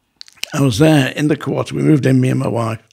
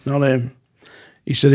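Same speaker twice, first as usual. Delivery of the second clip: ordinary talk, nothing marked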